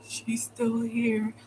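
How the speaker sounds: very slow, drawn-out mock report; put-on voice of a pleading caller; mocking caller imitation. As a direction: stretch each word like a scared tattletale. Imitates the caller once more.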